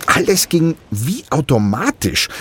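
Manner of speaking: lightly voiced boy, puzzled; young Diego, lightly voiced. A narrator's reading. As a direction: Diego a bit lost at sea, lightly voiced.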